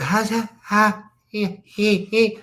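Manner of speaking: whiny mock speaking in tongues; imitated whiny babble. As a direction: mimic her sick, garbled nonsense syllables. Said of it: "sort of, um, sprawled and a little whiny".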